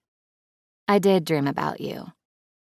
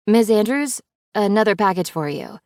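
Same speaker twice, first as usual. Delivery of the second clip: playful, louder, higher sing-song; teasing tone; 6 semitones higher, 4 dB louder than usual. Acted. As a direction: tease her boss with a grin. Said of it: "confused at her tone".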